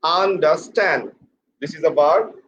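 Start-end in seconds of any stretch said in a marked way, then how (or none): 0.0-1.1 UNDERSTAND slowly as an-da-STAND, falling; stress on last syllable. Shown as a demonstration. Teacher drilling pressure on the last syllable, stressed an-da-STAND. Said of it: "Put pressure on the last syllable".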